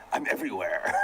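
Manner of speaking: laughing through a mock villain line; laughing imitation. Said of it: "why you sound like a villain?".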